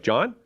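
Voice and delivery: John? with a rising question tune; curious rising John?; questioning name. Shown as a demonstration. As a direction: say the name as a question, then wait. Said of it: "pause with a question mark at the beginning".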